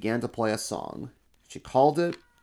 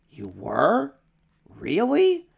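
high, loud delighted disbelief; can't believe his luck; surprised emperor; 9 semitones higher, 5 dB louder, much slower than usual. Acted. Emperor hears about the surprise party.

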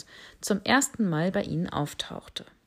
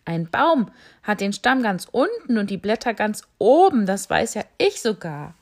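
giraffe calf; 3 semitones higher, 7 dB louder than usual. Acted.

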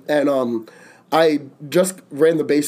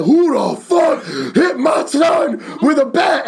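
dad's furious shout; 9 semitones higher, slower than usual. Retold or imitated. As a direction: bellow it like an enraged father arriving. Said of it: "He said".

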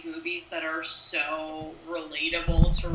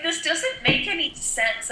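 confused; 9 semitones higher than usual. Retold or imitated. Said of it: "I'm like".

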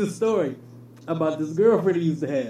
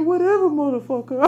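put-on sassy girlfriend; 6 semitones higher than usual. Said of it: "she hit him with the".

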